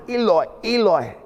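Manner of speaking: high, strained gasp of words; breathless gasp. Acted. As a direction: imitate a dying man gasping it out. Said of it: "imagine that gasping word".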